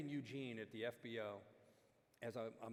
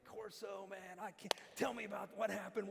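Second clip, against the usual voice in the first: much higher, eager rush; excited fan; 9 semitones higher than usual. Retold or imitated. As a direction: gush like a thrilled fan. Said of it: "almost jumps into my arms".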